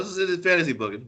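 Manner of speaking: normal talking voice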